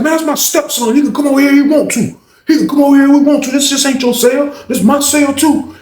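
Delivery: high, forceful argument; heated comeback. Retold or imitated. Acts heated comeback in quarrel.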